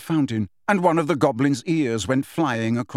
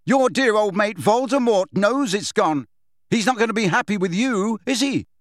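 higher-pitched, mocking jeer; goading taunt; 7 semitones higher, 3 dB louder than usual. Harry needling Bellatrix about her master, taunting. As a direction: goad the enemy, voice lifted.

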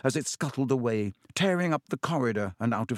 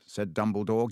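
usual speaking voice

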